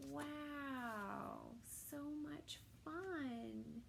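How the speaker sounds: ordinary delivery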